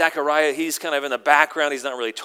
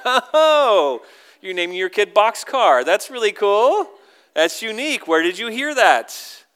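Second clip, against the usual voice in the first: higher-pitched, put-on polite interest; mock-impressed, pitched up; forced politeness; 7 semitones higher, 6 dB louder than usual. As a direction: react politely to a weird name. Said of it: "they don't even respond politely".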